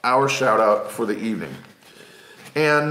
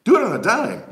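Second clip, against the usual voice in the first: high, louder goofy character voice; silly voice; 8 semitones higher, 3 dB louder, faster than usual. Shown as a demonstration. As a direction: squeak it out like a cartoon. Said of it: "Maybe I'll start doing the voices".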